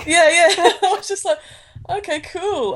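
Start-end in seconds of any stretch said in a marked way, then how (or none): 1.9-2.7 high, softer, unsure acknowledgement; awkward reaction. Retold or imitated. Recognised by a fan and unsure how to react, awkward high reply. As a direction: play it lost for a reply. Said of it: "I don't really know how to react".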